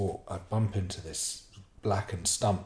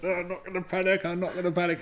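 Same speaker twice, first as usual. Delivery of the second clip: high, fast, tight self-talk; holding back panic; 10 semitones higher, faster than usual. Retold or imitated. Storyteller holding on for dear life among hornets, barely contained panic. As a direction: insist you won't panic while nearly panicking. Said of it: "holding on for dear life".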